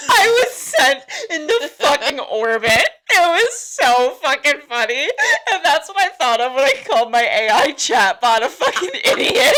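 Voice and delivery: talking through real laughter; laughing hard. Real, not acted. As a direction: tell it while cracking up. Cracking up retelling funny video.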